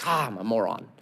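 low, soft mock groan of shame; sunken, soft acted self-reproach; self-deprecating. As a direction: mutter it as humbled Peter. Peter humbled before Jesus.

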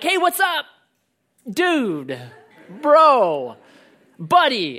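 high, laughing mock bro greeting. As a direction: fake the buddy-buddy hello to someone whose name you forgot. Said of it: "So then I'm like".

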